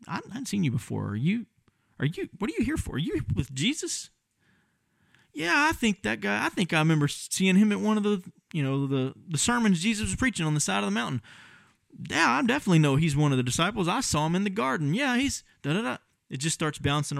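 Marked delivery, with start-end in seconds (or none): 0.1-4.1 higher, softer, probing questions; suspicious bystander. Acted. Bystanders questioning Peter by fire. 5.3-16.0 higher and quicker, piling it on; eager accuser. Acted. Bystander accusing Peter, gossiping.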